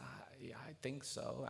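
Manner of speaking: hushed, hesitant murmur; timid response. Retold or imitated. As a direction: answer meekly, barely voiced. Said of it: "some kind of timid response".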